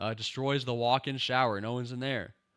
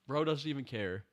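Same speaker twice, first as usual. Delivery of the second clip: ordinary delivery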